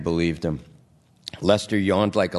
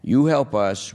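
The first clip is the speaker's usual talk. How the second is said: usual speaking voice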